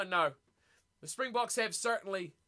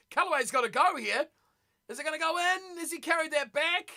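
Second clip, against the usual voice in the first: rising excitement; 6 semitones higher, 4 dB louder than usual. Real, not acted. Live rugby, tense moment.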